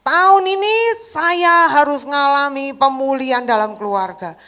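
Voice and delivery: loud slow preaching; preaching declaration. Acted. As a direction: declare it boldly to the church. (mengkhotbahkan dengan lantang dan lambat)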